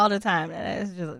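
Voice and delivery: ordinary talk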